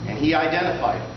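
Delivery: usual speaking voice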